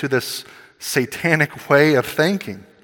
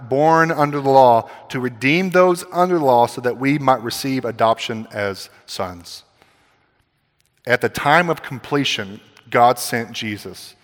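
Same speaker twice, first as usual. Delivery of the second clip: usual speaking voice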